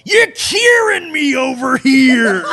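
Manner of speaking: high, slow comic yell; imitated shout. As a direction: stretch it out in a hammed-up holler. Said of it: "but Steve shouts".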